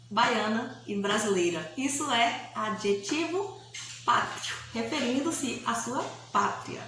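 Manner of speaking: ordinary delivery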